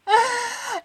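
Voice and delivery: high squealing real laugh. Real, not acted. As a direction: burst into a shrill giggle. Laughs at her own prank.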